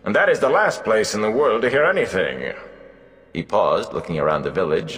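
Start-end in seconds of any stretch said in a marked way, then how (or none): 0.0-2.5 lightly higher, amused bard voice; mocking old bard. Gleeman scoffs at the young wisdom, amused. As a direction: scoff at her like a showy minstrel.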